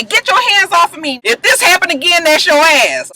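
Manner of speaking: high-pitched mock anger; indignant retort. Jamal warns the man touching him, indignant retort.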